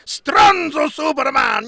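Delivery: high, slow, hammed-up shout; mock announcer shout. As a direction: belt it out like a cheesy promo. Mock-announcing a cheesy gag.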